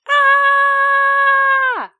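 very high, loud shriek; high scream. Acted. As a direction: shriek like a tiny terrified germ. Germ screams at the wash.

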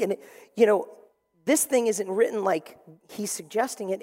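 normal talking voice

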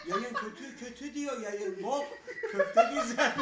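high, loud words broken by laughter; squealing through laughter; laughing hard. Real, not acted. Laughing hard while joking.

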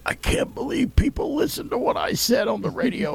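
put-on high, thin old man on his deathbed. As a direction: play a frail old man marveling. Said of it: "I'll still sit there and go".

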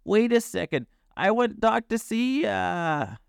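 high-pitched eager plea; barked plea. A narrator's reading. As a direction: call after the runaway in an excited dog voice. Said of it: "Sprocket barked".

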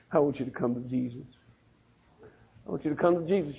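soft, hushed urging to a son; tender plea. Retold or imitated. Mother quietly urging her son to come to Jesus, soft and tender. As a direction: say it gently, almost under the breath.

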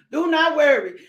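ordinary talk

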